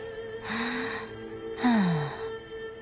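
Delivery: long, soft exhaled sigh. Acted. Shrine maiden waiting on the patient to come round, soft sigh. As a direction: let out a slow breath. Sighs while testing the patient.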